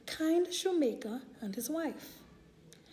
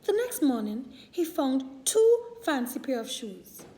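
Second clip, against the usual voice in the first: ordinary delivery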